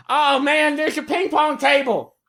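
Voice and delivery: put-on very high, excited young boy calling out from inside; squeaky kid voice shouting with delight; excited kid yelling. DM voices Mookie yelling excitedly from inside the house. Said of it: "you hear him yelling from inside".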